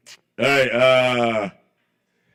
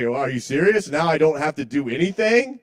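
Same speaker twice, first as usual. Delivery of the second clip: mock amazement; 5 semitones higher, 4 dB louder than usual. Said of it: "And I was like".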